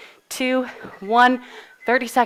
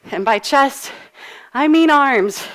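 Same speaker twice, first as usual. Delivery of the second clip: normal talking voice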